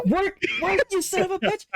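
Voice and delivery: very high, frantic cursing; comic high-pitched desperation; vexed pleading. Retold or imitated. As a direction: beg and curse the machine in a high voice. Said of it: "I felt so vexed".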